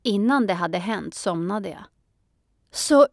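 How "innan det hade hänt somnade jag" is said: ordinary delivery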